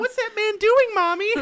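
put-on high loud child voice. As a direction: ask like a startled kid. Child voice in story.

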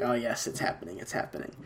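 soft, then fully whispered; hushed whisper. Retold or imitated. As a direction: whisper it like a secret plan. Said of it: "I was like".

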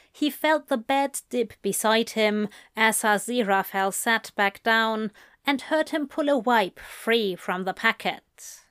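ordinary delivery

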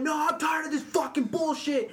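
high-pitched mock shout of protest; retold angry yell. Teller imitates Josh refusing to be held back by coaches, high indignant yell. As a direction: act out a fed-up player's outburst. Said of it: "I hear Josh yelling".

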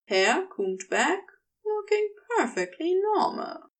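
usual speaking voice

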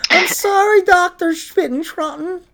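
high, meek sorry to the doctor; apologetic patient. Pretend. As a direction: whimper an apology to the German doctor. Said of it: "Some German doctor".

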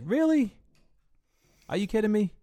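high, loud disbelief; incredulous. Retold or imitated. Bouncer scoffing at the drunk's claim, high disbelief. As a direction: pitch up sharply, can't believe it.

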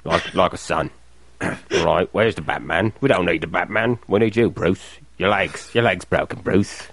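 a hint of Michael Caine, tender and fatherly; low, understated Michael Caine voice; Michael Caine impression. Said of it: "Was my Michael Caine okay".

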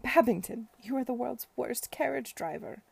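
loud, high, thrilled teasing; exhilarated scolding. Acted. Esther teasing Babington at full gallop, loud and thrilled. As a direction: scold him while loving the speed.

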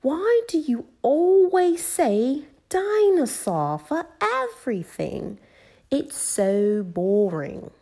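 high, drawn-out exasperated whine; Peppa, annoyed. A narrator's reading. Peppa to George about his dinosaur word, exasperated. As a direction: complain slowly like a fed-up little girl. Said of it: "Peppa got a little bit annoyed".

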